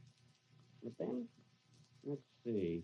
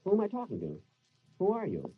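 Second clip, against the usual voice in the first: higher, louder, startled questions; frightened by a voice from nowhere; alarmed; 8 semitones higher, 4 dB louder than usual. Acted. Benny startled by a voice in his empty room, alarmed.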